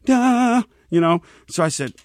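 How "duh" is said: mock duh. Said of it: "where he goes".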